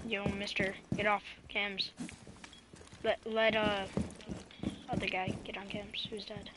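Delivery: whispered callout; hushed, breathy whisper. Real, not acted.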